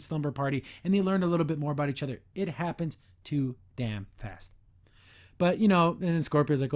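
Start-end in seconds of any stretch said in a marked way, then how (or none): 2.3-4.5 low, measured exasperation, word by word; slow, deliberate emphasis. Real, not acted. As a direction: space out "too damn fast". Exasperated complaint about rushed pacing.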